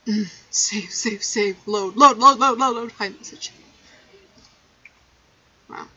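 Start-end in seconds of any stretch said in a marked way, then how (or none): none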